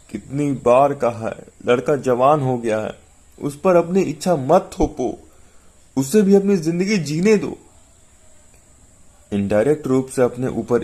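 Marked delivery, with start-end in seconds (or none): 0.1-7.6 higher, pained plea; sorrowful pleading. A narrator's reading. Wife pleading with husband to let their son live, sorrowfully. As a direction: plead unhappily, pitch climbing as you go. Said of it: "वो गमगीन स्वर में बोली".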